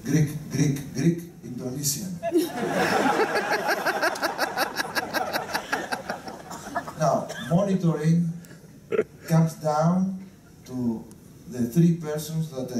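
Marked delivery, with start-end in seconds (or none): 2.2-7.0 hearty laugh. Real, not acted. Captain laughing at crew joke.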